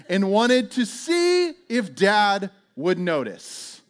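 drawn out, word by word; slow, emphatic punchline. Retold or imitated. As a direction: stretch it slowly for comic disbelief. Slow punchline, then repeated plainly.

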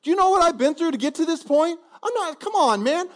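high, loud indignant protest; an offended servant arguing back at God; arrogant. Pretend. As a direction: scoff like you're owed respect. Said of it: "real arrogant about it".

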